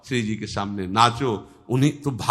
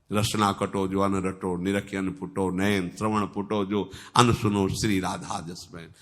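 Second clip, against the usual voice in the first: low, soft recited verse; devotional verse recitation; 5 semitones lower, 7 dB quieter than usual. Acted. Preacher recites Radha's praise to devotees, low devotional recitation.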